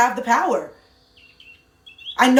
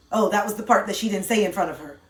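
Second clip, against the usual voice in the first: ordinary talk, nothing marked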